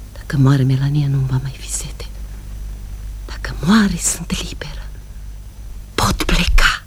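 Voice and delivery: breathy secret whisper. Acted. Woman confessing a dark wish, whispering. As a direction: hiss the dark thought softly.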